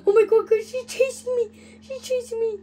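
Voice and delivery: high-pitched alarm, bursting out loud; panicked yelp. Pretend. Gamer telling guys she's chasing him, high panicked yelling. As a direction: gamer cries out to viewers while being chased, panicked. Being chased in the game.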